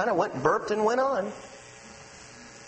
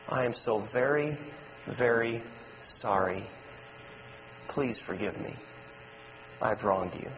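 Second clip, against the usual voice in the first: mean every word of the sorry; sincere apology; 9 semitones lower, 6 dB quieter than usual. Shown as a demonstration. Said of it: "But said with sincerity and care".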